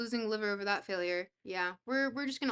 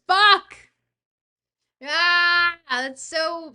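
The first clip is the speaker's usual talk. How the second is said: loud, raised cry of exasperation; frustrated outburst; 6 semitones higher, 14 dB louder, much slower than usual. Real, not acted. Streamer upset over a stupid score, frustrated outburst. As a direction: explode with frustration at yourself.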